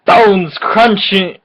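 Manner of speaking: ordinary talk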